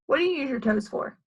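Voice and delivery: plain reading voice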